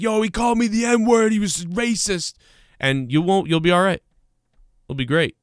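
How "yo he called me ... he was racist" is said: higher, hammed-up accusing cry; half-shouted staged accusation; mock scream. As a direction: fake outrage to the crowd. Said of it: "just scream like".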